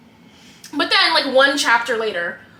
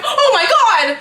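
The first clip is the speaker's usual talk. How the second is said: high, loud laughing "oh my God"; mock shocked gasp; 10 semitones higher, 8 dB louder, slower than usual. Friends seeing the couple together, laughing mock shock. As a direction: act out their shock, hammed up. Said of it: "they're both like".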